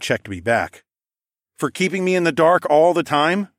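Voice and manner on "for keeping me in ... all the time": higher, louder teasing question; smirking. A narrator's reading. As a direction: echo it back with a rising smirk. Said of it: "I smirked".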